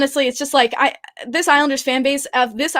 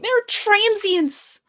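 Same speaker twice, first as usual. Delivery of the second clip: high, drawn-out mock complaint; stretched, higher-pitched put-on outrage; imitated disdainful woman; 8 semitones higher, much slower than usual. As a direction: mimic an indignant woman, stretching the word high. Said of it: "the woman who was like".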